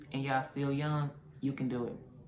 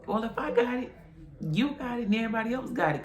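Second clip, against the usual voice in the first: high-pitched, louder comeback to a sister; indignant retort; 8 semitones higher, 4 dB louder than usual. Retold or imitated. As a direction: throw it back at her, pitched well up. Snaps back at sister about covid.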